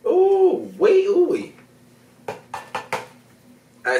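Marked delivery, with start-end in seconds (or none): 0.1-1.5 high, gleeful ooh wee; excited whoop. Real, not acted. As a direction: whoop it up with delight.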